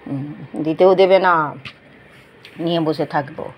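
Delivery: low, slow, stubborn pet's voice; voicing the pet. Owner speaks for stubborn Mixi, low and sulky. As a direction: play the sulky dog refusing to share.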